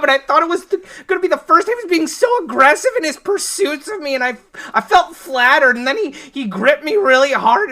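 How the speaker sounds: high, loud, breathless panic ramble; frantic confession. Acted. Elaine bursts in confessing she stabbed the mailman, frantic. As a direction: spill it all out in a panic.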